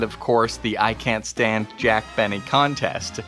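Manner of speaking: ordinary delivery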